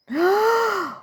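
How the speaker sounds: high sharp gasp; startled gasp. Acted. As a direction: gasp in fright. Narrator startled.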